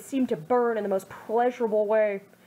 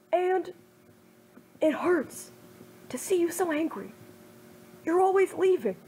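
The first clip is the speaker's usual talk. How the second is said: put-on raised, earnest young man's voice, worried and hurt; a friend begging Naruto to stop, pleading and raised; Kiba pleading; 4 semitones higher than usual. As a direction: plead with him not to destroy himself, fading to a hurt hush at the end.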